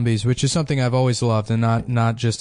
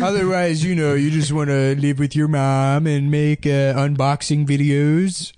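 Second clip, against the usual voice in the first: slow mock nerd in a made-up accent; drawn-out weird accent; That Sodding Gamer; 2 semitones higher than usual. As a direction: needle the gamer in their own invented accent. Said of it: "this weird accent he made up".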